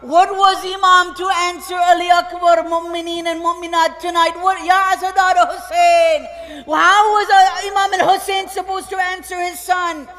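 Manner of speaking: loud preaching lament to the mourners; impassioned sermon cry. Acted. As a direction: call out the question to the congregation. Preacher cries out to congregation.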